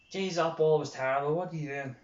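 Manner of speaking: loud exasperated scolding, acted out; mock telling-off, loud at the start; mock berating. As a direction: imitate a critic having a go at a player. Critical shout at erring player, acted.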